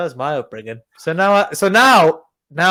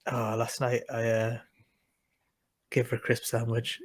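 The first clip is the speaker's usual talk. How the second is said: mumbled sheepish admission, much softer; sheepish dad confessing; 8 semitones lower, 14 dB quieter than usual. Retold or imitated. Dad confessing to mum about the crisp sandwich, sheepish mumble. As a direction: own up to it, quietly hoping to get away with it. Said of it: "Dad's going".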